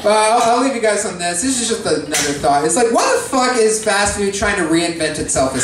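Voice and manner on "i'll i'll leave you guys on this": ordinary talk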